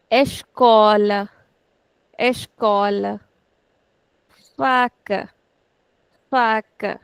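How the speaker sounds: escola, faca, gato, hospital each slow, stress on second last; slow and clear, leaning on the second last syllable; slow model words. Shown as a demonstration. Teacher reading Portuguese words slowly for students, stressing the second last syllable. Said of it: "We'll put stress on the second last".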